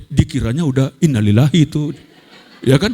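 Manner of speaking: ordinary talk, nothing marked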